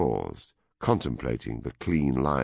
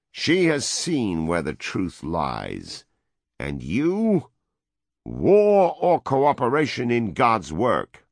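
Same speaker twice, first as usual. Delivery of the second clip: slow, higher and loud demand; demanding American; 7 semitones higher, 4 dB louder, slower than usual. A narrator's reading. American presses the vice president, slow loud demand. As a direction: press him hard for an answer.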